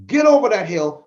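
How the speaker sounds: ordinary talk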